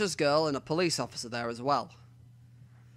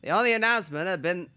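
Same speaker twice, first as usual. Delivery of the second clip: reporter voice; 4 semitones higher, 5 dB louder than usual. Acted. Voicing the reporter Watabe's video.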